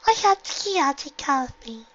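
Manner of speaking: put-on high, slow child voice; little girl voice. A narrator's reading. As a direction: speak as a sick little filly. Sick little sister speaks.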